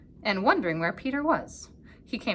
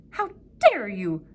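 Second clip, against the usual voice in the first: snarled; 6 dB louder, slower than usual. A narrator's reading. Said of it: "snarled the wolf".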